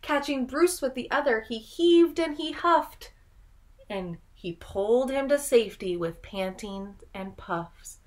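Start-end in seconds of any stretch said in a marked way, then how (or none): none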